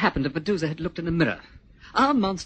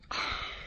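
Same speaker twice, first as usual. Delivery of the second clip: heavy sigh; 9 dB quieter than usual. Acted. Weary pause.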